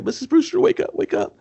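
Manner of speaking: very high frantic mock voice; mock panicked Vincent. Vincent frantically wakes Missus Brewster, high squeal. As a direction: squeal it like a scared coward. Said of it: "He's like".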